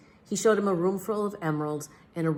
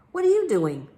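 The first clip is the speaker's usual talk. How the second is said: higher, louder bird voice; chirpy birds; 8 semitones higher, 6 dB louder than usual. A narrator's reading. Birds greeting the flying detective, chirpy. Birds talk to Big Max.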